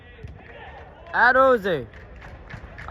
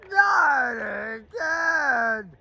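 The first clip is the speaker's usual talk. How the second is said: anguished wail; 2 semitones higher, much slower than usual. Real, not acted. Team concedes chance again.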